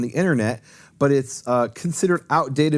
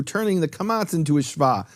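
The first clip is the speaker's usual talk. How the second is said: usual speaking voice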